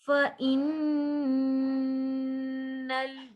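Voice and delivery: फ़इन्नल with the double n hummed long through the nose; ghunna. Shown as a demonstration. Teacher showing ghunna, फ़इन्नल with a long nasal n. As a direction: chant फ़इन्नल, holding the nasal n. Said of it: "गुन्ना".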